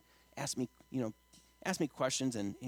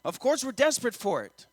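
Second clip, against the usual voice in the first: raised, driving sermon voice; impassioned preaching; 5 semitones higher, 6 dB louder than usual. Acted. Preacher urging congregation to surrender to God, impassioned preaching. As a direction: build to loud, high peaks from the pulpit.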